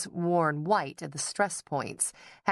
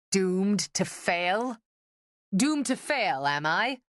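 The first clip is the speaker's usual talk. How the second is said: mock outrage; 4 semitones higher, slower than usual. A narrator's reading. Father's hammed-up outrage.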